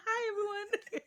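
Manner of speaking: high, laughing hello; mock cheery greeting. Imitating her greeting everyone, mock cheeriness.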